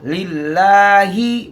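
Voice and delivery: लिल्लाही very slow with thin लाम, slight rise; thin lam. Shown as a demonstration. Teacher models लिल्लाही for students, slow with thin lam. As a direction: stretch लिल्लाही gently, लाम kept light. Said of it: "ये बरीक पढ़ा जाएगा".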